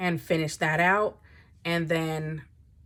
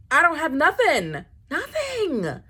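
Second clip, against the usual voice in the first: nothing soaring much higher in a despairing wail; mock-tragic cry, pitch way up; dramatic wail; 10 semitones higher, 4 dB louder than usual. Real, not acted. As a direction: wail it like the end of the world. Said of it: "I'm not trying to be dramatic".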